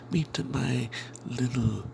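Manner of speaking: usual speaking voice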